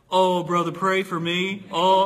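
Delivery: loud, high begging cry; mock loud plea. Gym guy loudly begging the preacher to pray for him. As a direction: call out for prayer, hammed-up. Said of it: "gym guy's plea".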